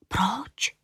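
put-on soft, mysterious child's whisper; secretive whisper. A narrator's reading. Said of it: "šeptala tajemně Julinka". (tajemný šepot)

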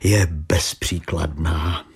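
slow, low, deliberate bluntness; blunt. A narrator's reading. Detective bluntly rounds off his remark on decency, blunt. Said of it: "prohlásil Exner neomaleně".

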